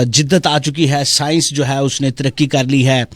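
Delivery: ordinary talk, nothing marked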